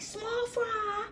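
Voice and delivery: high, long-held call. Retold or imitated. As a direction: imitate a drawn-out call to someone in another room. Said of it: "And he called".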